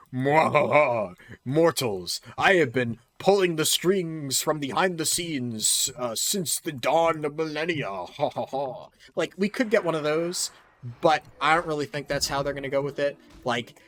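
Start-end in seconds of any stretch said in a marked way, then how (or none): 0.1-1.1 evil laugh. Pretend. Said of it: "and it's like". 1.4-8.9 slow, gloating villain taunt; evil villain voice. Pretend. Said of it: "and it's like".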